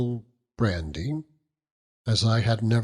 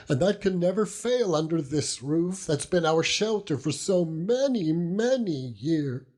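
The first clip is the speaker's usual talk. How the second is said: put-on higher, earnest old widow woman's voice; pleading old widow; 8 semitones higher than usual. Old widow comforting grieving Dannel, higher pleading voice. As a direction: beg Dannel gently, voice lifted high.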